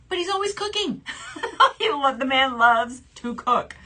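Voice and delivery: giggling, pitch up high; laughing. Real, not acted. Telling viewers about her fiance's cooking, laughing high. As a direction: laugh through it fondly.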